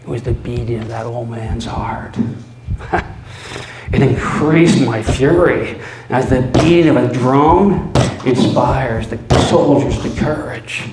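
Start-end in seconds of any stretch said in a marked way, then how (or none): 2.2-3.9 mad laugh. Acted. 3.9-10.9 much louder, driving; fury. Acted. Narrator raging at the heartbeat, loud.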